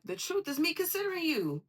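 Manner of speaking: high, insistent comeback; emphatic mock retort. Aries defending blunt truth to a hurt friend, emphatic retort. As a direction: push it out high as self-justification.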